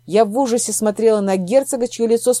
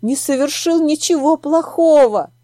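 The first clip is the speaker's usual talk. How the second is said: higher, shaky with grief; trembling voice; 5 semitones higher than usual. A narrator's reading. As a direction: let the voice tremble. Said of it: "мой голос задрожал".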